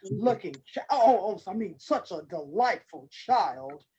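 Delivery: low, smooth wolf voice, stumbling over a slip; hungry wolf covering up with politeness; low and courtly, slowing on the question; sly wolf. A narrator's reading.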